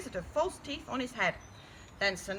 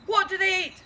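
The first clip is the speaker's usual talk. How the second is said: loud, raised question; shouting a question; 8 semitones higher, 8 dB louder than usual. A narrator's reading. The knight shouts to the wizard about bait, loud call. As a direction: call the question out across the field. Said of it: "shouted Sir No Bonk".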